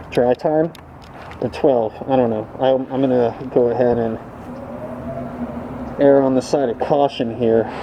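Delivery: muffled behind a respirator mask; through a respirator. Real, not acted. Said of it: "I'm still speaking through my respirator".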